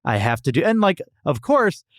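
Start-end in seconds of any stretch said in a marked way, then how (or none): none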